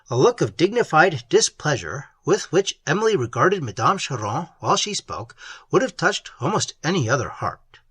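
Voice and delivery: ordinary delivery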